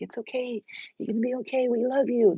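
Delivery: high, tender soothing voice; acted reassurance. Good spirits comforting her daughter, high and tender.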